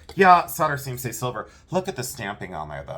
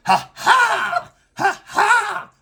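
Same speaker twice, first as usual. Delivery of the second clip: very high, loud honking cough-laugh; honking goose laugh; 8 dB louder, much slower than usual. Retold or imitated. Son imitates mom's goose-like honk in the parking lot. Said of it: "It sounded like a dying Canadian goose".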